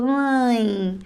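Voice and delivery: "ãe" as one long nasal glide from a to e. Shown as a demonstration. Teacher showing how the written sound blends, drawn-out "ãe". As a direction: stretch "ãe" through the nose. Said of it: "O som ãe remete à união".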